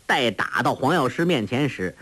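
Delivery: ordinary delivery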